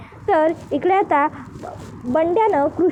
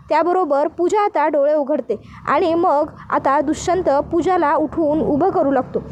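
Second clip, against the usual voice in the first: ordinary delivery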